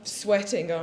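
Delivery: usual speaking voice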